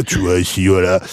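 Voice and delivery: low, close-mic smooth tone; suave voice. Shown as a demonstration. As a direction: lean in and go smooth. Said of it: "je peux faire pareil".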